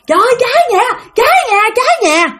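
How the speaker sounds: high, urgent mock holler; raising the alarm. Aunt next door alerting everyone to fire, raising the alarm. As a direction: imitate a neighbour's panicked fire alarm cry. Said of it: "la làng lên".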